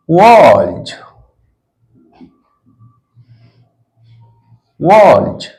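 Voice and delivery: वॉल्स said slowly with a voiced z ending; teacher modelling वॉल्स for the class; model pronunciation. Shown as a demonstration. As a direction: sound out वॉल्स as walz, ending on a buzzing z. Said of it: "एस जोर का plural बनाते हैं".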